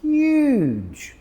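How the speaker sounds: "huge" said very high and long in awe; "huge" high and drawn out with wonder; amazed emphasis. Shown as a demonstration. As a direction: marvel at the palm tree on "huge". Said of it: "Look at the size of that palm tree".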